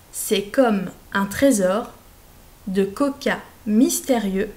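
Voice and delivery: slow word-by-word dictation; dictation reading. Shown as a demonstration. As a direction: read out each group clearly for pupils. Dictée lente, mot par mot. (dictée lente et articulée)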